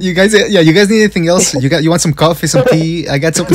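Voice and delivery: mock an over-helpful gofer offering refreshments; eager coffee boy. Mocking him as coffee boy.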